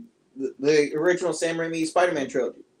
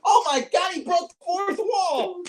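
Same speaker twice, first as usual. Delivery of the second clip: delighted amazement; 11 semitones higher than usual. Retold or imitated. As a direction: gasp it like a fan. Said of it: "I'm like".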